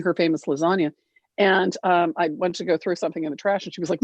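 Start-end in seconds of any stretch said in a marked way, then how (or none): none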